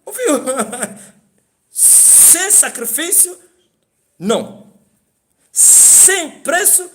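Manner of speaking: high laughing, slowed exclamation; laughing emphasis. Real, not acted. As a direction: laugh while hammering the point. (ênfase com riso)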